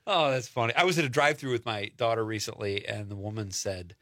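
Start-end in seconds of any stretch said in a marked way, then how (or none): none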